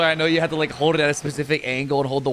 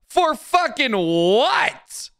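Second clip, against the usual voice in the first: much higher and slow, stretching For fucking what; incredulous outrage; 11 semitones higher, much slower than usual. Real, not acted. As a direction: drag it out in disbelief. Streamer mocking demand for thanks.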